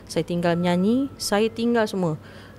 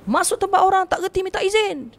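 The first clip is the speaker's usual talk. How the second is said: much higher, indignant spirit boasting of its hidden weapons; ranting spirit; 12 semitones higher, 3 dB louder, faster than usual. Retold or imitated. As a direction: rant high-pitched as the wronged spirit. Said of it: "dia kata macam tu". (makhluk halus marah, suara sangat tinggi)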